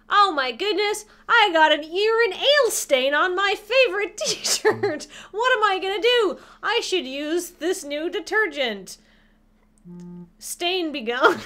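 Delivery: high, loud, over-dramatic ad housewife dismay; mock commercial. Streamer acting a detergent ad, hammed-up.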